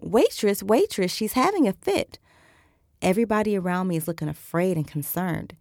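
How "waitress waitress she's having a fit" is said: louder, higher urgent call for the waitress. A narrator's reading. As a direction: call across the restaurant in alarm. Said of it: "The client is calling".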